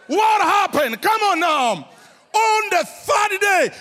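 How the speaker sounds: high shouted call to crowd; preaching shout. Real, not acted. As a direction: rouse the congregation, full voice. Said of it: "Come on, say louder".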